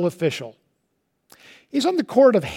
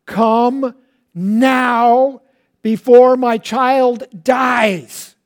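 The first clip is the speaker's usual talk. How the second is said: louder, very slow pleading cry, higher; desperate cry; 6 semitones higher, 6 dB louder, much slower than usual. Retold or imitated. Father cries out to Jesus, desperate and slow. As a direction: beg Jesus to come before the child dies. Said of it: "he just cries out even more desperately".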